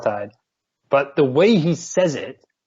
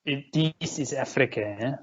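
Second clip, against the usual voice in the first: put-on South African accent; 2 semitones higher, 7 dB quieter than usual. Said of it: "That's what he says".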